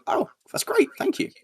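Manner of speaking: high put-on thanks. Recalling a fan's praise, he answers in a high, bashful voice. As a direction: play it up as a flustered thank-you.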